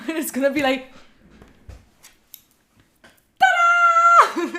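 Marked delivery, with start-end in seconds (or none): 3.4-4.5 a much higher, louder, showy "ta-da"; a big pretend reveal of the boyfriend; mock reveal. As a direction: present it like a magic trick.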